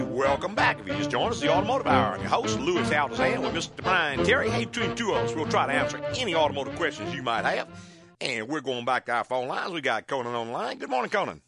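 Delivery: ordinary talk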